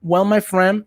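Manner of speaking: ordinary delivery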